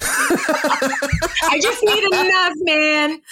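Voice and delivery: slow, high, laughing junkie plea; twitchy slurred sniffing addict. Pretend. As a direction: beg for a fix through laughter. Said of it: "sounded like a drug addict".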